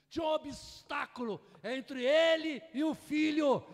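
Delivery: loud, high, slowed preaching cry; fiery preaching. Acted. Pastor urging the church at full preaching force. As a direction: build up and shout it to the church.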